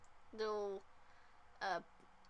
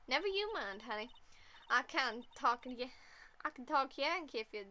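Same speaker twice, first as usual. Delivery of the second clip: reassuring southern drawl; folksy southern voice. Acted. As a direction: put them at ease in a southern twang. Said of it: "I like speaking in a southern drawl".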